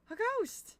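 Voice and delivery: high, loud startled yelp at a ghost; sudden high shriek of surprise; startled cry. Real, not acted. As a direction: jump at the sight and yelp.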